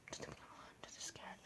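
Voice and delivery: faint breathy whisper. Retold or imitated. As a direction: whisper it like you want to hide. Whispering retold stress.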